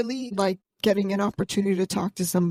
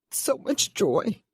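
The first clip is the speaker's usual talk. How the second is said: slow, choked-up joy; real emotion welling up, words drawn out; getting emotional; 2 semitones higher, slower than usual. Real, not acted. Podcaster tells guests what recording means to her, getting emotional. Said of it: "I'm getting emotional".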